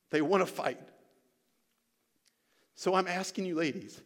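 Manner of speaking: real emotion, with long pauses; emotional. Real, not acted. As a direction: preach it earnestly, moved by the plea. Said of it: "sorry I got a little emotional there".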